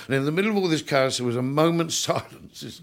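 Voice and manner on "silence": usual speaking voice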